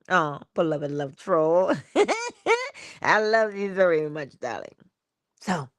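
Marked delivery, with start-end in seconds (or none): 1.8-3.0 high giggling laugh; a bright burst of laughter. Real, not acted. Laughing at her bird.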